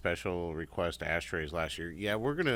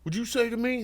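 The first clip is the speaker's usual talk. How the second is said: much higher, louder indignant squeal; mocking squeaky challenge; 6 dB louder than usual. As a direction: ridicule him with a shrill outraged squeak. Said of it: "And he's like".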